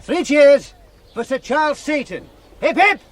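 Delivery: slow, loud and high cheer call; rally the crowd from the front wagon; leading cheers. Acted. Schoolmaster leads cheers from the wagon.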